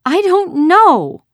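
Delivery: high, loud, drawn-out tearful protest; choked voice. A narrator's reading. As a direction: wail it as a girl starting to cry. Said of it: "she said, her voice choked".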